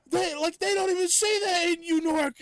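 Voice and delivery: high, loud hammed-up yell; mock screaming. Arguing with family about schmear, high mock scream. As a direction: shriek it in a fake argument. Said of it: "I was screaming".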